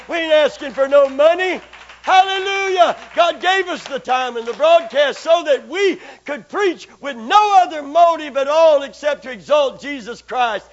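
loud, high preaching cry; preacher thundering praise; full-force preaching. Acted. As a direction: lift the congregation with a shout.

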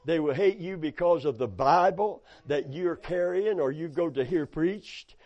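normal talking voice

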